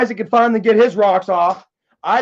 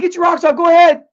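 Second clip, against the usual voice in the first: higher, louder goading dare; egging him on to swing; loud mocking dare; taunting challenge; 8 semitones higher, 7 dB louder than usual. Taunting Isaac to hit him.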